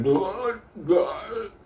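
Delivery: high gurgling mock bark; gurgled bark imitation; choked dog bark. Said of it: "his bark was like".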